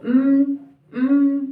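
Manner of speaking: hummed beats with the mouth closed, one per syllable; humming syllables. Shown as a demonstration. Teacher demonstrating syllable humming to students. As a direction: hum each syllable of bat with closed lips. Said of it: "तो मुंह में ही बोलो".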